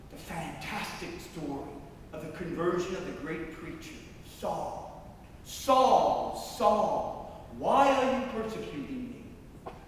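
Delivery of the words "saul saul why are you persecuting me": booming accusing call from heaven; commanding, raised-voice reproach; loud confronting call. Acted. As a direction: call out loudly, then demand an answer.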